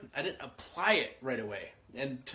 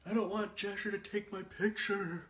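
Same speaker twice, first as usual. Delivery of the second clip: lightly voiced upset kid; 5 semitones higher, 5 dB quieter than usual. Son complaining to his parent.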